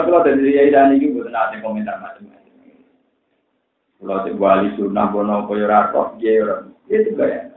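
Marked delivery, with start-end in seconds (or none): none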